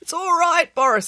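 high, squeezed squeak; strained, high-pitched storybook boy. A narrator's reading. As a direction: squeak as if being crushed in a hug. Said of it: "squeaked Derek".

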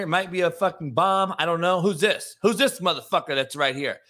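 ordinary talk, nothing marked

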